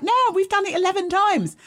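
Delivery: high, sustained mock-casual reply; young guys' boast. Voices two young guys replying.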